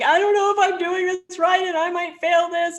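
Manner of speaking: high, strained panic near tears; panicked student. Retold or imitated. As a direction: blurt it out as if on the edge of tears. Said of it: "So I was literally crying".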